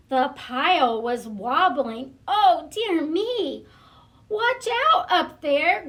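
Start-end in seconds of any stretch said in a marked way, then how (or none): 2.3-5.9 worried warning cry, much higher and louder; alarmed cry. Acted. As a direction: call up in alarm like a worried little dog. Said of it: "good Trevor did cry".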